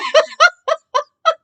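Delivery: high, loud burst of laughter; big laugh. Real, not acted. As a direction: let out a big laugh at yourself. Laughs after the crying story.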